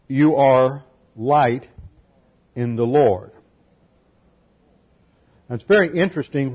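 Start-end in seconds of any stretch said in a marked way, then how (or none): 0.1-3.3 "you are light" drawn out slowly, each word weighted; slow emphatic. Real, not acted. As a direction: lean on "you are light" and let it land. Preacher stressing key verse.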